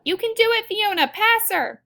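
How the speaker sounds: loud, high-pitched cheer from the sidelines; shouted cheer. A narrator's reading. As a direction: shout her on at the race. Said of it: "I shout".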